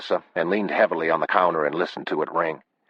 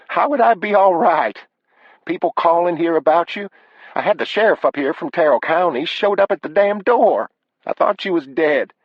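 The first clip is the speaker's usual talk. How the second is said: high, louder voice of an upset wife; high-pitched, agitated wife on the phone; distressed wife; 13 semitones higher, 5 dB louder than usual. Acted. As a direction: pour out the upset, pitched right up.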